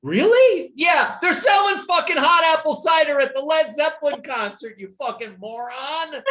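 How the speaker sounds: loud, high, mocking scorn at a dumb question; sarcastic yell. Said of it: "my other friend goes".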